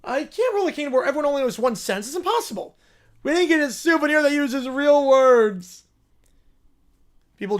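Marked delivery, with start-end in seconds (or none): 0.0-5.9 higher, louder bratty whine; whiny spoiled royal. Acted. Spoiled character complains about the flight, whining. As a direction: whine like a pampered little royal who hates travel.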